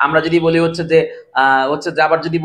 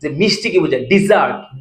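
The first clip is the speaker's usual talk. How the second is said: ordinary talk, nothing marked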